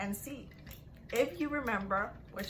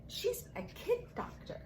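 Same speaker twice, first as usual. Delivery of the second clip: aha realization; 14 semitones higher, 5 dB quieter than usual. Acted. Child's sudden realization in story.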